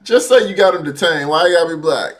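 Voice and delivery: loud, high laugh-through protest; laughing disbelief. Real, not acted. Laughing reaction to dispatch call.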